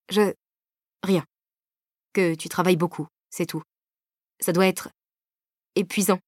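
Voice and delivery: halting, broken stammer. A narrator's reading. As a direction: trip over the words, hesitant. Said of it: "bégayai-je".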